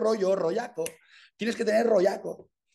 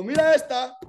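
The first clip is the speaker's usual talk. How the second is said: higher, louder impressed exclaim; 8 semitones higher, 6 dB louder than usual. Retold or imitated. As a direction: call it out as if impressed by her. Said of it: "Y yo veo eso y digo". (exclamación admirada, más aguda y fuerte)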